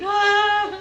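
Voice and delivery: high, loud cartoon scream; hammed-up shriek of terror; mock screaming. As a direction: yell like a panicked man running downstairs. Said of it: "you hear his shouting".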